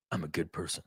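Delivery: low, soft mock sincerity; mock self-righteous. Mocking people who advertise their goodness, mock self-righteous.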